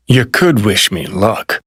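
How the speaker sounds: ordinary talk, nothing marked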